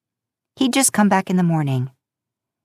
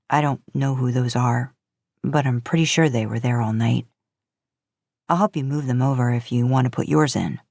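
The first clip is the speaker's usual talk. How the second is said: a hint of a lower, easygoing young man; slightly lower, relaxed guy offering help; friendly stranger in the laundry room, lightly lowered voice; Castiel, lightly voiced; 5 semitones lower than usual. A narrator's reading. Narrator voices Castiel's dialogue.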